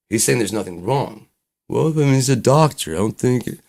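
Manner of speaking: high, loud insistence; arguing parent. Retold or imitated. Parent sticks up for the doctor, arguing.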